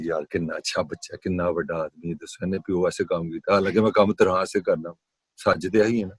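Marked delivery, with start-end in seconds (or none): 0.0-3.5 low, soft imitation of admiring listeners; quiet admiring murmur. Teacher imitating people impressed by his story, quiet and admiring. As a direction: lightly voice hushed approval, low in the register.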